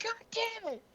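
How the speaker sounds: high-pitched imitation of a tantrum; mock angry yelling. Teasing a friend with their imagined outburst, mock yelling. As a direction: mimic someone yelling, without full volume. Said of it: "You'd be yelling and screaming at people like".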